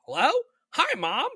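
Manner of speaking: very high squawky parrot mimic of a phone greeting; high mock parrot voice; parrot imitating mom. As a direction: play the parrot copying mom. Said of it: "And he'd be like".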